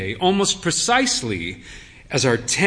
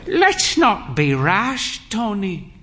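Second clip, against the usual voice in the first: put-on higher, slow, frail old lady; a proud old dear cautioning her son; slow high frail mother reining him in; old mother's voice; 6 semitones higher than usual. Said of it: "she said".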